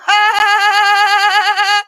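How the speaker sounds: high loud bleating; goat bleat laugh. Pretend. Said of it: "It's Kimmy Monster talking".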